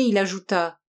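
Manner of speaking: usual speaking voice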